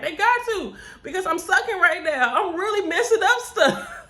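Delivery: high, loud words broken by laughter; laughing. Real, not acted. Tells her coworker she's messing up, high and loud, laughing. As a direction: laugh through the confession.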